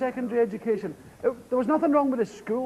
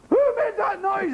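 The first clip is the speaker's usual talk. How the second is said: higher mock teacher demand; stern teacher; 10 semitones higher than usual. Teacher asking the class again, mock stern and higher. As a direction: play the schoolmistress asking sharply.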